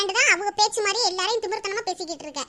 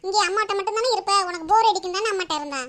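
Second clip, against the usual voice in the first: ordinary delivery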